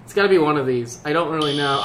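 ordinary talk, nothing marked

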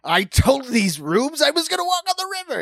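high, loud cocky boast; mock proud New Yorker. Mocking a committed New Yorker, cocky loud boast. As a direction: brag like a stubborn New Yorker. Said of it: "You're like".

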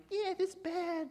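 high-pitched imitation of heckling kids; mock child jeer, pitch way up; kids freaking out. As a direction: squeal it like an upset kid. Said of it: "like, freaking out".

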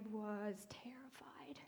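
slow, whispered confession; awed whisper. Real, not acted. Preacher recalls hearing God, whispering in awe. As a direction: let the fear hush the voice.

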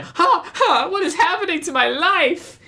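very high-pitched, hammed-up disbelief; terrible Taylor impression. Taylor reacting to being passed over, squeaky mock dismay. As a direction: badly imitate a girl squealing in dismay. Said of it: "That was a terrible impression".

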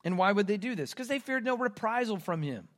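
usual speaking voice

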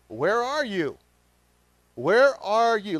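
calling God. A narrator's reading. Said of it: "the Lord God called to the man".

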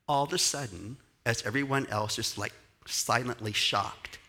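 ordinary delivery

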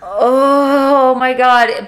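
gushing fan reaction, hugely elongated; mock swoon. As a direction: stretch it out slowly, overwhelmed by the scene. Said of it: "and it's just like".

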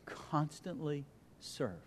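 hushed answer. Shown as a demonstration.

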